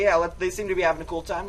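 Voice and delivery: usual speaking voice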